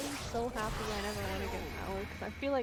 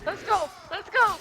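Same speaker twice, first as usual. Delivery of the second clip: hockey player cheering; 10 semitones higher, 4 dB louder than usual. Acted. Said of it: "I sound like a hockey player right".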